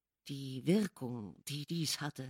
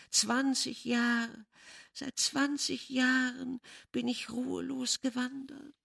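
lamenting; 7 semitones higher than usual. A narrator's reading. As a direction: mourn twenty years of wandering. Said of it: "klagte die Stimme".

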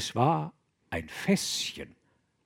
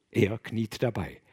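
ordinary delivery, nothing marked

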